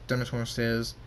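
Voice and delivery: ordinary delivery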